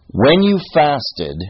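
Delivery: FASTED leaned on, high and slow; emphatic scripture reading. Acted. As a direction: read it out with weight on "fasted". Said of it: "emphasizing the word fast".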